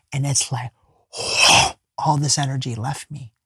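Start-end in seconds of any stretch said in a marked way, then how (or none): 1.1-1.7 a sudden unvoiced intake of breath; sharp gasp. Real, not acted. As the energy leaves his body, a sharp gasp.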